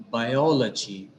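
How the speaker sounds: biology with a long, accented second syllable O; biology slowly, leaning on the O; stress on O. Shown as a demonstration. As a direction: draw out the O in biology. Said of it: "it is o".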